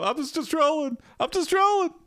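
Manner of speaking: very high, put-on little voice; silly high-pitched mock excuse; squeaky bard. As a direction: squeak it out as a cornered joker. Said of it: "stands up and you go".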